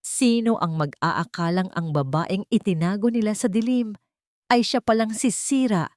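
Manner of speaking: plain reading voice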